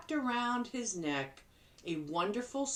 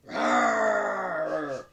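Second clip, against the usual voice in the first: loud growling bear noise; 5 dB louder than usual. Acted.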